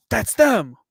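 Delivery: high, loud mock cry of recognition; imitating an alarmed shout; cops calling out. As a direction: call it out like cops spotting suspects. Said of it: "all the cops are like".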